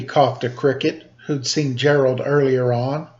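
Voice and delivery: ordinary delivery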